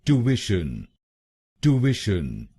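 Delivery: ट्यूशन as "too-ish-un", no y sound; American pronunciation. Shown as a demonstration. Teacher modelling American ट्यूशन for Indian learners. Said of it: "American English में इसको बोलेंगे".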